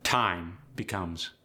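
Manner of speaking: ordinary delivery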